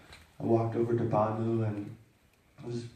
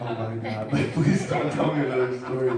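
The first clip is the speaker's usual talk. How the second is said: louder, laughing mock plea; laughing exasperation; 7 dB louder than usual. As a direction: groan about more stories with a laugh.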